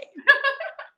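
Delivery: squeaky giggle; short rising giggle; high laugh. Real, not acted. Laughing at joke.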